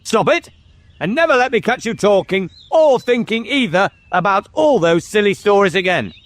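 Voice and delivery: high-pitched, emphatic tirade; angry scolding. Acted. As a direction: lay down the law to a child. King scolds and shakes the boy.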